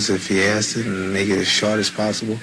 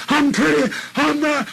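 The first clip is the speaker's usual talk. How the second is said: boastful shout. Retold or imitated. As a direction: crow it like a champion. Retold boast.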